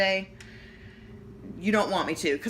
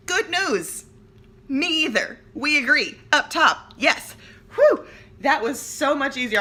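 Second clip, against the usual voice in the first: much louder and higher, cheering; excited; 10 semitones higher, 10 dB louder than usual. Retold or imitated. Mother cheering with son over no baby, loud excited. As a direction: celebrate like you just won.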